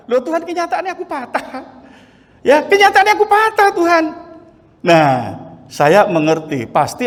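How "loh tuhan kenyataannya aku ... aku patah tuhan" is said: indignant but half-joking, reaching up; protesting to God. Retold or imitated. Preacher acting out his complaint to God, lifted disbelief.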